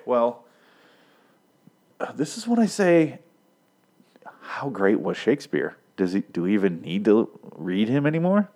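ordinary talk, nothing marked

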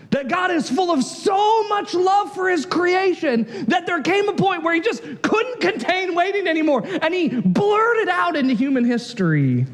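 rising, high preacher's cry; fervent preaching. Acted. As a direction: preach it as God's love bursting out. Preaching at full force.

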